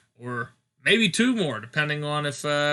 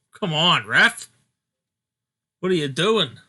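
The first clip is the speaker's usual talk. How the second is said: frustrated shout at the ref; yelling from the sidelines. Real, not acted. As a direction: call out to the referee.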